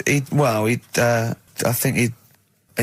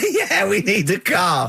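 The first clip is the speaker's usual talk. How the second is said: 1 laughing caveman; 6 semitones higher, slower than usual. Pretend.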